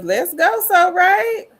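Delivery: high, loud hype shout; excited cheer. Real, not acted. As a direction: cheer them on, pitch soaring. Cheering on a chat member.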